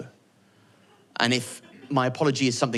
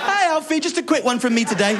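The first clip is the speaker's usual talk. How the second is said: much higher and louder, chirpy and casual; a cheery mum making a suggestion; breezy mum voice; 13 semitones higher, 5 dB louder than usual. Retold or imitated. Mum phoning her son, breezily cheerful. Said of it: "She made it sound like a suggestion".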